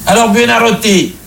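interpellation nettement plus aiguë; pape qui interpelle. Acted. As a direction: pape casse-pied qui surprend son peintre, voix haut perchée. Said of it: "le pape appelait Michel-Ange par son nom propre". (appel soudain et aigu du pape)

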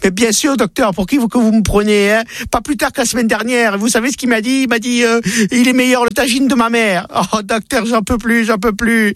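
high, indignant woman's voice; wife protesting to her doctor, high and exasperated. Acted. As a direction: protest in a high voice that she already tried. Sarah answers the doctor, exasperated.

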